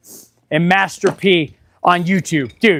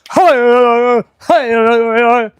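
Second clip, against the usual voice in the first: mock silly greeting; 2 semitones higher, slower than usual. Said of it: "he's like".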